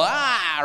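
high mock scream; short, high "aah" of fright; demons' yell. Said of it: "and shudder or yell".

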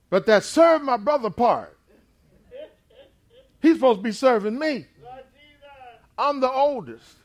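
put-on loud, high, hurt protest as the robbed older son; indignant outcry. Esau protesting to his father that Jacob stole his blessing, loud and wounded. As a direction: cry out that he was cheated. Preacher voicing wronged Esau.

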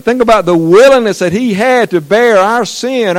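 loud, high fervent sermon voice; preaching at full force. Acted. Preacher urging the congregation to picture the cross, in full fervour. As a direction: lift it up and preach.

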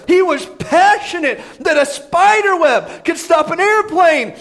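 loud, high sermon build; full-force preaching. Acted.

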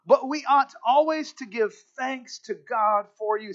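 ringing scripture read. Acted. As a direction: proclaim the verse to the church. Reading scripture aloud, raised.

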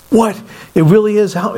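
surprised. Retold or imitated. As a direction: react to news you can hardly believe. Stunned reply to surprise phone call.

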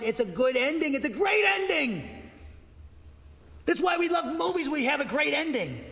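rising joyful preaching, high and loud; excited. Real, not acted. Preacher to his congregation, rising joyful and loud. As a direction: lift it up with delight.

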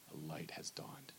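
whispered scripture, drawn low; hushed prophecy. Acted. Preacher reading Isaiah's prophecy in a hushed whisper. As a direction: breathe the prophecy like a secret.

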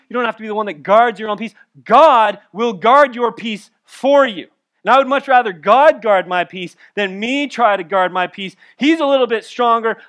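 God will guard your peace punched out; full-force preaching. Acted. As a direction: preach it hard to the congregation.

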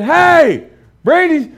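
high, loud call. Retold or imitated. Dad calling to his daughter, high and loud. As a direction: call out sharply across the room. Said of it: "And I said".

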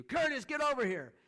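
imitated high, very loud yell; yelling boss. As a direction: yell it like a boss across a noisy loading dock. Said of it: "they're yelling at you".

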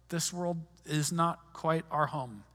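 ordinary talk, nothing marked

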